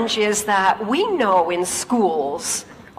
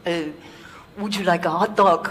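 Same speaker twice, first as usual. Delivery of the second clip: lightly imitated French accent, hesitant; a casual French host's offer, in a French accent; 5 semitones lower than usual. French engineer offers hot dog.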